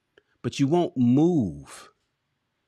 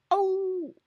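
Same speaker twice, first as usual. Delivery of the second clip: high, softer hound howl; dog howl; 3 dB quieter than usual. Retold or imitated. Hound dog asked why he stays put, howling. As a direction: howl long like a hound on a nail. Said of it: "the hound dog looks at him".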